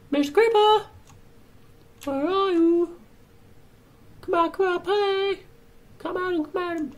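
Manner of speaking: high sing-song come-out-and-play call; coaxing call. Pretend. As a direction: lure it out like a creepy game of hide and seek. Calling a creeper out to play.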